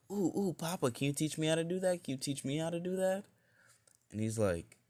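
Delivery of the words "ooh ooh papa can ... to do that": put-on high, fast eager little girl voice; excited child. Little girl begging her papa to teach her, excited.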